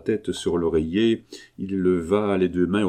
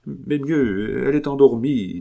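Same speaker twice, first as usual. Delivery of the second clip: unconvincing reassurance; 6 semitones higher, 3 dB louder than usual. Acted. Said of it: "d'un ton de voix qui démentait ses paroles".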